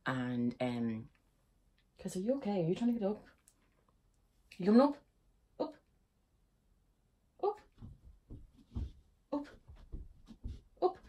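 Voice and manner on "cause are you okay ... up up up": talking to the dog. Real, not acted. Coaxing her dog up.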